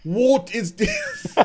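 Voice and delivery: high, drawn-out fake Scottish accent; mock Scottish accent. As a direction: stretch it slowly in a silly Scottish accent. Repeats his botched Scottish accent.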